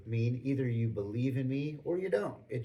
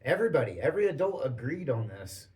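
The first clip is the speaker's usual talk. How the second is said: normal talking voice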